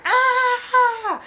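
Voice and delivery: high, piercing mock scream of pain; retold scream while pushing; labor scream. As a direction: let out a birthing scream.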